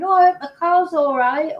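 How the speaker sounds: hammed-up worried bystander; worried passerby. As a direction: voice a fretting car owner's thought. Imagined worried thought of passerby.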